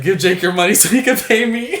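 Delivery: high, loud playful imitation of a mod; mock plea. Streamer voices his mod begging to get paid, mock plea. Voicing the mod's plea for pay.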